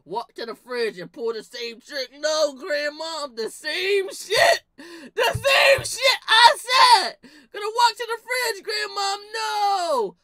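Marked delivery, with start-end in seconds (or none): 2.2-10.2 slow, very high, loud mock sobbing; hammed-up crying wail. Cousin overplaying the trick to grandma, slow wailing cry. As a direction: drag out the fake cry like a busted kid. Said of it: "my cousin going dicky".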